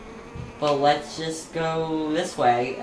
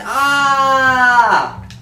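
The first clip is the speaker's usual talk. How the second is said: loud high cry at the crack; a mortified shout; startled yelp; embarrassed yelp; 10 semitones higher, 9 dB louder than usual. Real, not acted. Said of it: "My voice cracked".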